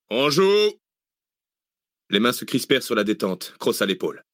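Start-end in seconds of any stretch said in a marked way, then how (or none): 0.1-0.8 high, drawn-out military call; shouted firing command. A narrator's reading. Mercenary chief readies his men to fire, shouting. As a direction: shout it rising to the squad.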